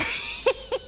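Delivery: put-on shrieking old crone laugh; high witch cackle. Cackles at own joke.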